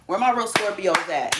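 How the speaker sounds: normal talking voice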